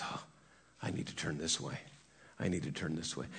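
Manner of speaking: soft, low horse thinking aloud; still small voice. Retold or imitated. Preacher voices horse gently yielding, soft and low. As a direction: quietly voice the horse's small realisation. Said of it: "which would be a still small voice".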